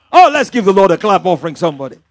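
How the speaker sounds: loud, fired-up preacher's cry; rousing call to the congregation. Real, not acted. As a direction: whip up the crowd for applause. Preacher calls congregation to clap.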